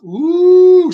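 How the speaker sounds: gleeful ooh at the payoff; loud high ooh. Real, not acted. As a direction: whoop like you just saw the hit land. Reacting to the skillet attack in the story.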